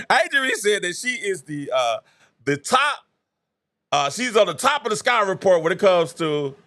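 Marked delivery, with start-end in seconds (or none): none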